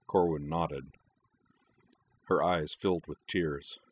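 ordinary delivery

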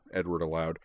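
usual speaking voice

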